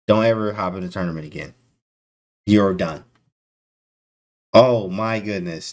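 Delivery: normal talking voice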